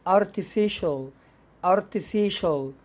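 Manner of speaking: artificial said ar-ti-fi-shul; teacher demo of artificial with sh; sh sound in artificial. Shown as a demonstration. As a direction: artificial slowly with sh. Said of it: "هتنطق شين".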